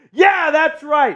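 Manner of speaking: rally the fans behind you; hype shout. Real, not acted. Hyping Miami win.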